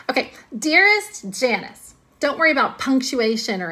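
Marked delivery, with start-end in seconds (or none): 0.6-1.9 slow, higher swooning "Dearest Janice"; mock romantic. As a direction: lean into "Dearest", a bit dreamy. Redoing the letter opening.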